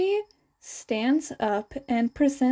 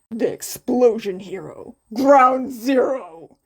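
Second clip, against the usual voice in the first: proud announcement; 6 dB louder than usual. A narrator's reading. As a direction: proclaim it proudly, show-off. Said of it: "Kacchan proudly announced".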